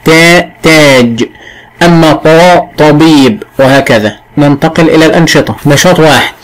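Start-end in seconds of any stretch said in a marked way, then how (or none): 0.1-1.3 تا تاج said slowly, تا kept thin and unemphatic; light taa. Shown as a demonstration. Teacher showing the light taa in تاج, slow and clear. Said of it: "حرف مرقق لا بد من ترقيقه". 1.8-3.4 طا طبيب said slowly with a heavy, emphatic طا. Shown as a demonstration. Teacher showing the emphatic طا in طبيب, slow and heavy. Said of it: "الطاء أنه مفخم".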